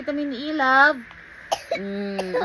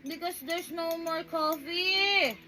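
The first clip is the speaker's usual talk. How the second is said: higher pretend crying-baby voice; voicing baby Daniel; 5 semitones higher, slower than usual. Mother speaks for crying baby to viewers, pretend baby voice. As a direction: play a tearful baby addressing the viewers. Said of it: "I'm Daniel. I'm crying".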